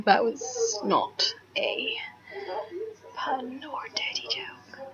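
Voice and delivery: slow, very soft whisper; whispered aside. Real, not acted. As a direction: whisper the disclaimer slowly under your breath. Joking disclaimer as an aside.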